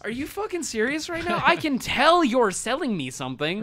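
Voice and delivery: mock fed-up rant at the salesmen; exasperated outburst. Retold outburst at pushy salesmen.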